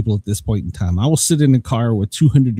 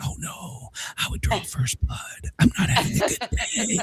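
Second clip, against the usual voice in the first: hushed menace; 8 semitones higher, 11 dB quieter than usual. Retold or imitated. Retold threat to woman in line.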